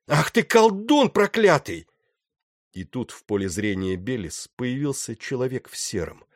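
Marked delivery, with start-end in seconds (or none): 0.1-1.9 high, loud cursing full of hatred; hate and fear. A narrator's reading. Captain cursing the man in grey, hateful and afraid. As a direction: spit out the curse in frightened fury. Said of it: "Голос его был полон ненависти и страха".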